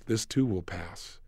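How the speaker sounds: slow, soft and low; a quiet, reassuring mantra; calm self-talk. Retold or imitated. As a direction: soothe yourself gently. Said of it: "I tell myself".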